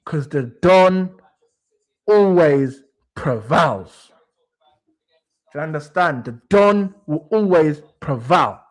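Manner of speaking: low and slow, a lightly done godfather; deep mob boss voice. Pretend. Fan telling Fauzi why he talks like this, slow low mob boss. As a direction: drawl it out like the Don. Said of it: "Berta is the reason I talk like this".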